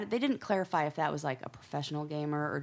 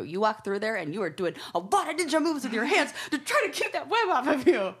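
laughing; 7 semitones higher, 4 dB louder than usual. Retold or imitated. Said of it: "I'm like".

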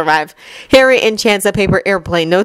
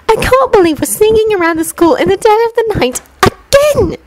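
high, exasperated schoolgirl grumble; complaining Hermione; 14 semitones higher than usual. A narrator's reading. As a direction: grumble it up high like a fed-up girl. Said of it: "complains in a whisper".

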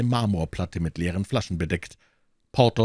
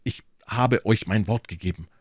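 ordinary delivery

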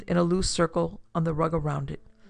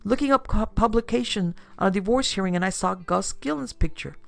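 pleading excuse, pitched up; lightly acted defensive appeal; 5 semitones higher, 4 dB louder than usual. A narrator's reading. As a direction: protest your innocence in a raised pitch. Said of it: "Lyndon appealed to Dodd".

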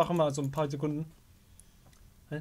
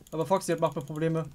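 ordinary talk, nothing marked

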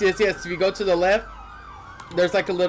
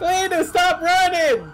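high, loud, drawn-out shriek; slow screaming; 10 semitones higher, 3 dB louder, slower than usual. Acted. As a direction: scream his name at him. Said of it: "I've got you screaming at me".

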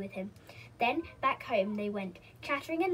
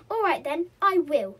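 loud, raised-pitch huffy retort; offended, louder and higher; 5 semitones higher, 11 dB louder than usual. A narrator's reading. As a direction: announce it in a huff.

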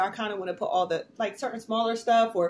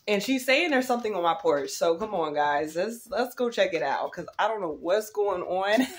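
ordinary delivery

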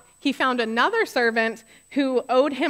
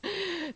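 heavy sigh; 7 semitones higher, 9 dB quieter than usual. Real, not acted. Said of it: "Suck the air out of the room".

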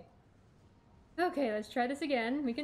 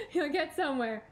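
said through a laugh, a bit higher and louder; 3 semitones higher, 5 dB louder than usual. Real, not acted. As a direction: laugh at your own pep talk. Repeats the line, laughing.

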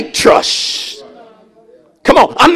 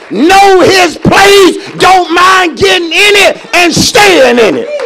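drawn-out, punched words in a rousing sermon; full-force preaching; 6 semitones higher, 3 dB louder than usual. Real, not acted. Preacher telling men to lead, full-force preaching. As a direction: hammer each phrase out to the congregation.

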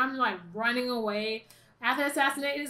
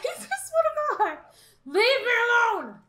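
raised, high-pitched exasperation; exasperated outburst; 8 semitones higher, 4 dB louder than usual. Real, not acted. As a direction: snap at them to back off. Pestered by enemies in game.

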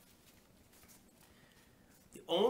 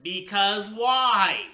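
slow, loud, higher demand; father's grouching; 7 semitones higher, 13 dB louder, much slower than usual. A narrator's reading. Father demands a reason from daughter, grouching. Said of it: "he grouched".

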